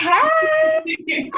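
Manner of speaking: high, loud cheering hey; excited shout. Real, not acted. Host cheering her guest's arrival, high loud shout. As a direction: whoop it out with joy.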